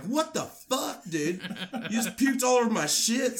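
imitating her dad yelling at the puker, laughing through it; angry old man. Her old man scolding the guy who puked, mock outraged and high. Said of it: "her old man's like".

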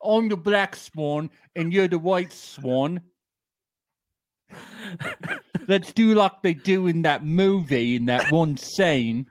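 posh pretend Brit voice; mock British accent. Host playing Shane in a put-on British accent. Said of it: "has that accent".

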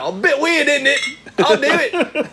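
high, put-on little kid voice; mock little boy. Still playing the little boy with Craig, coy and high. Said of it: "I'd be like".

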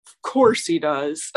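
low-pitched sarcastic retort. Retold or imitated. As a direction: imitate a popular guy's dry sarcasm. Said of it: "really sarcastically".

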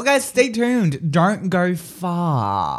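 slow, stretched-out host tease; drawn-out radio sign-off. Pretend. Host tells listeners to stay tuned, drawn out.

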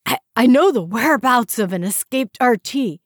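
high, loud, anxious stammer; nervous stammer. Acted. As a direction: rush out the secret. Prisoner nervously spills secret.

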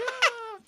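high horrified gasp; the family's high horrified gasp; shocked gasp. Pretend. Family reacts in horror.

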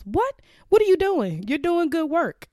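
high, startled disbelief from the doctor; shocked doctor. Retold or imitated. Doctor hearing she's quitting, shocked and high-pitched. Doctor reacting to her resignation.

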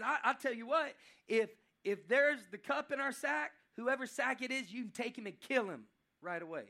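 high, very loud, daring them; defiant challenge. Acted. Judah daring the warriors to search the sacks, loud defiance. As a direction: lay down a bold wager, settling toward the end. Judah stakes life on innocence.

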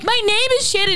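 mock screaming. Insisting to Liz outside the bar, high mock yell. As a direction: replay the shouting match in a squeaky high voice. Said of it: "screaming at each other".

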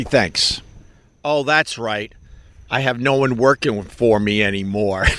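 ordinary talk

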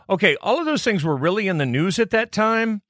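ordinary delivery

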